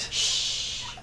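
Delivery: a sharp whispered shush; hushing whisper. Acted. Lizzie hushing Roger while he hides, in a whisper. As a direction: hush him without making a sound. Hiding, keeping Roger quiet.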